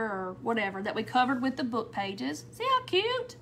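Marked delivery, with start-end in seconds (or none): 2.5-3.4 much higher, bursting with delight; delighted squeal. Real, not acted. Crafter to her viewers, delighted high squeal. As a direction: squeal over how cute it is. Showing off finished flower.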